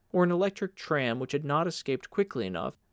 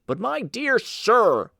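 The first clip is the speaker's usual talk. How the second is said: higher, louder, slow protest; astonished cry; 9 semitones higher, 7 dB louder, slower than usual. Acted. Polander protests to Uncle Jacob in astonishment, crying out higher and louder. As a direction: cry out in astonishment, stretching each word. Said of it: "cried Mister Polander".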